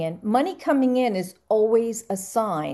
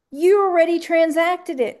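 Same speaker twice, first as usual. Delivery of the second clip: emphatic point; 8 semitones higher, 5 dB louder than usual. Real, not acted. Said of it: "emphatic teaching".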